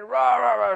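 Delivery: roaring "rah" of a screaming man; imitated screaming. Preacher imitating a man screaming during prayer, mock roar. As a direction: mimic a wild scream, high and drawn out. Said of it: "screaming at the top of his voice".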